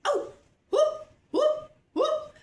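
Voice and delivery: a sudden high whoop of joy; excited whoop. Real, not acted. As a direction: whoop it up in delight. Bursting into excited cheer.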